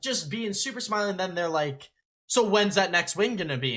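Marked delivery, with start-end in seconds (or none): 2.2-3.8 mock needling question, raised and a bit louder; angry asshole reporter. Reporter pressing a losing player, mock-angry and obnoxious. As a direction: imitate a reporter being a jerk. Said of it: "in a really angry asshole sounding way".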